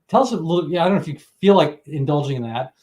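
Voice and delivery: normal talking voice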